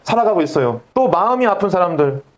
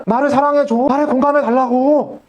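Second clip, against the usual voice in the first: higher, voiced as hurt people begging to be loved; pleading cry for love; 5 semitones higher than usual. Pastor voicing hurting people crying out for love, higher pleading. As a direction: plead like a wounded soul asking for love. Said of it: "계속 뭐라고 하냐?".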